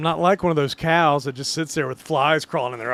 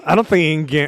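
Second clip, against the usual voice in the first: ordinary talk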